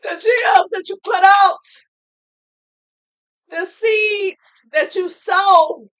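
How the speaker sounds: a real tearful cry of conviction; emotional shout. Real, not acted. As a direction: preach it through real tears. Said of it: "I'm gonna get emotional like this".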